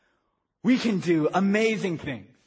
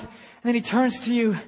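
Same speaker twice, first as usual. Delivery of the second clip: ordinary talk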